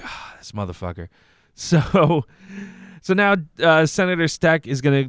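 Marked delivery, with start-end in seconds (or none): 0.0-1.1 muttered aside. Retold or imitated. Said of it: "he just shook his head like".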